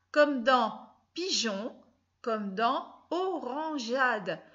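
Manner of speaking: comme dans pigeon, comme dans orangeade, spelled out slowly, each syllable clear; slow dictation. Shown as a demonstration. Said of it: "pour faire ja et pour faire jo". (prononcer pigeon et orangeade lentement, en détachant le son j)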